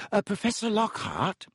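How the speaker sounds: stammering. Acted. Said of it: "Hermione stammered".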